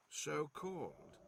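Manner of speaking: put-on scornful old man. A narrator's reading.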